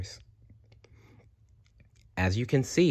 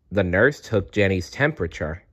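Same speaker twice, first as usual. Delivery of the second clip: plain reading voice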